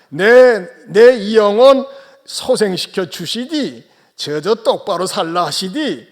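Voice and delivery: hammed-up North Korean Pyongan dialect. Pastor amuses the congregation with Psalm 23, Pyongan dialect. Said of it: "평안도 버전입니다".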